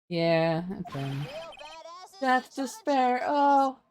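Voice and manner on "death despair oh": slow, high moan of doom; hammed-up despair. Reading the game's despairing client, slow wailing moan. Reads dramatic game line.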